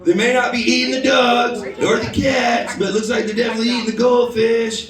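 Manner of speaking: hammed-up bad presidential impression; Donald Trump. Comedian mocking the fish eaters, bad Trump impression. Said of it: "That's my worst Trump impression".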